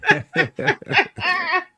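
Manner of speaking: sudden high-pitched burst of loud laughter; high shrieking laugh. Real, not acted. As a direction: cackle out loud in delight. Friend finds remark hilarious.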